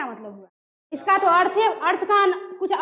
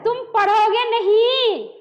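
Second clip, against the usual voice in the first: raised, slow, stern demand; angry teacher; 4 semitones higher, 3 dB louder, slower than usual. Shown as a demonstration. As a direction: scold a stubborn pupil, dragging the words. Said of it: "अब teacher को गुस्सा चढ़ा". (गुस्से में, खींचकर)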